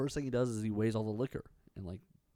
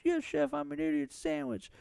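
meek woman. Retold or imitated.

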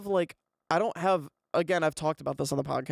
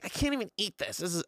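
lightly voiced complaining mom, a bit higher; toned-down retold complaint; tired, grumpy mom; 3 semitones higher, faster than usual. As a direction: mildly imitate mom in a bad mood. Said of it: "tired and kind of in a bad mood".

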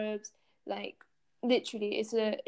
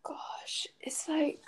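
whispered aside; 5 semitones higher, 7 dB quieter, slower than usual. Real, not acted. Aghast aside on colonial permission.

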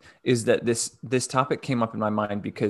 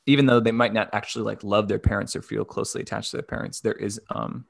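ordinary talk, nothing marked

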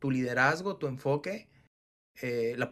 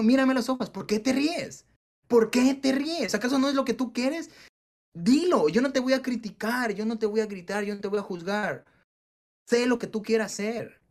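much higher, louder coaching shout; coach yelling; 8 semitones higher, 4 dB louder than usual. Retold or imitated. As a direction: fire them up, loud and high. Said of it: "a toditos los hice gritar".

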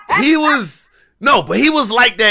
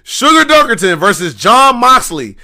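higher-pitched imitation of a ring announcer; match announcer; 4 semitones higher than usual. Guy on the mic announcing the tournament, imitated announcer. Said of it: "gets on the mic and starts announcing".

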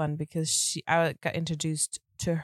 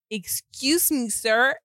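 higher, louder indignant challenge; mock indignation; 7 semitones higher, 5 dB louder, slower than usual. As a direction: playfully call him to order. Retells her outraged reply to him.

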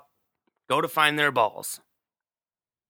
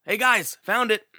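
raised, higher call across the course; mild retold yell; 7 semitones higher, 4 dB louder than usual. Golfer calling to his group, a mild mock yell. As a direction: call out to friends, a touch pleased. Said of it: "Fred all of a sudden yells".

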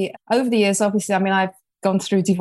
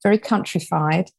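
usual speaking voice

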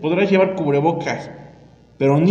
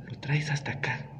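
whispering; 2 semitones lower, 12 dB quieter than usual. Real, not acted. Whispered aside about mask misuse.

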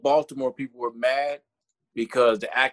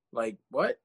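ordinary talk

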